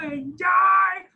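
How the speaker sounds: long, high, loud hammed-up cheer of enjoy; a mock party yell of enjoy, dragged out slowly; drawn-out cheer. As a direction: stretch enjoy into a gleeful shout. Repeats the word, hammed up.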